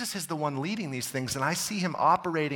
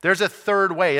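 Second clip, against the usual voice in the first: ordinary talk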